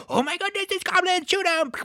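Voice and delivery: high, alarmed cry on spotting goblins; mock alarm. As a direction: act out a startled high-pitched warning. Voicing riders spotting goblins.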